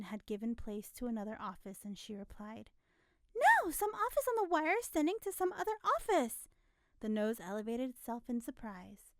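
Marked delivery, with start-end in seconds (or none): 3.3-6.5 exasperated. A narrator's reading. Natty explaining, exasperated.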